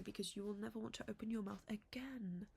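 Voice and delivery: whispered punchline, no voice. Real, not acted. Vlogger whispering a joke to viewers, whispered. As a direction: breathe it like a secret.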